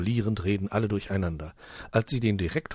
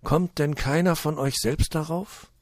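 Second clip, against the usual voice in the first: sad; 8 semitones higher, 4 dB louder than usual. Acted. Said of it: "blickt alle am Tisch traurig an".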